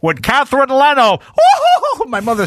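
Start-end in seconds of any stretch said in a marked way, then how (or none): 0.0-1.2 loud, high announcer page over the store speakers. Retold or imitated. The store manager pages his embarrassed mother, loud announcer voice. 1.2-2.0 a burst of loud, high laughter. Real, not acted. Jay laughs loudly, recalling his mother being paged.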